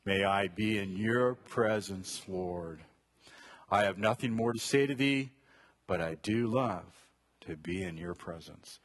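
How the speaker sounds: hushed prayer; quiet prayer. Shown as a demonstration. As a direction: lead the congregation in prayer softly. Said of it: "Let's all say that together".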